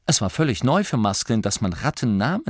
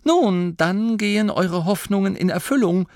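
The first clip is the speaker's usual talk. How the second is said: proud announcement; 10 semitones higher, 3 dB louder than usual. A narrator's reading. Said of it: "verkündete Angelo stolz".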